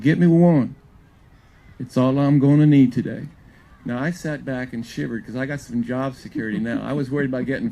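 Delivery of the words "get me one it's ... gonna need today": commanding pitcher. Retold or imitated. Pitcher orders his teammates to get him a run, loud command. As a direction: bark it out to the whole bench. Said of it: "He said".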